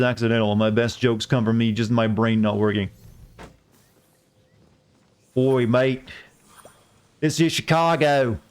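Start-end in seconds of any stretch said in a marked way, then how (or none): 5.3-8.4 hammed-up Australian mobster, drawn out at first; Australian accent. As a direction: play a Chicago mobster as an Aussie. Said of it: "give you the mob an Australian accent".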